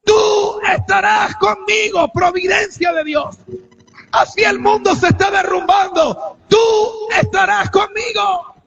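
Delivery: high, loud, drawn-out pulpit cry; full-force preaching. Real, not acted. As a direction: preach it at the top of your voice. (prédica a gritos, con toda la fuerza)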